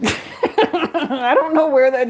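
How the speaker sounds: laughing. Real, not acted. Cracking up at own joke.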